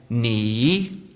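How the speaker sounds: "Ni" dipping down, then rising up; "Ni" with a falling-rising Mandarin tone. Shown as a demonstration. Speaker teaching the audience Mandarin, "Ni" dipping then rising. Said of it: "So you're gonna go down and up".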